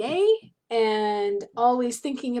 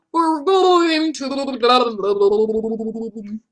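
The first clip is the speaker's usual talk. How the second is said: bubbly, garbled singing as if underwater; 6 dB louder, much slower than usual. Pretend. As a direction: sing it with your mouth full of water. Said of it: "underwater voice?".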